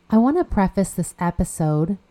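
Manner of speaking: normal talking voice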